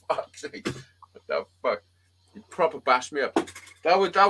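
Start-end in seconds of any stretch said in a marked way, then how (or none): 0.1-1.8 muttered complaint. Retold or imitated. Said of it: "I'm like".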